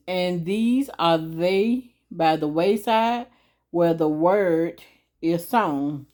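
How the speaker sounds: steady slow recital of the verse; slow reading. Shown as a demonstration. Explaining the verse slowly to the audience. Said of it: "I'm gonna read it a little slow".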